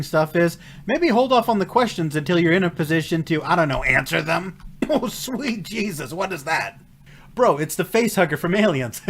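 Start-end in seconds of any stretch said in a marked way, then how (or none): none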